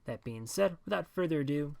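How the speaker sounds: ordinary delivery, nothing marked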